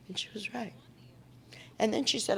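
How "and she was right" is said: whisper. Real, not acted. Quiet aside about her mother.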